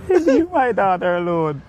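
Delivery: high-pitched imitation of a scolding elder; playful high-voiced reprimand; mock scolding. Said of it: "him scold me one day".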